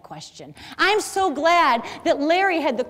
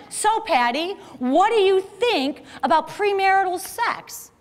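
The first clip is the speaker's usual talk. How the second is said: slow, stilted question said again; a light mimic of an awkward guy pressing his question; awkward Jerry repeating; 6 semitones higher, slower than usual. Storyteller mimics Jerry asking the same question again, slow and stilted. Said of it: "He says it again".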